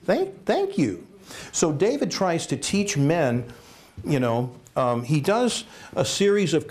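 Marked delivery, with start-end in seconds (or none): 0.0-1.1 forced polite thanks, pitch rising; mock grateful gift-opening; fake gratitude. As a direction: pretend to love a bad gift. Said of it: "you open that box and you go".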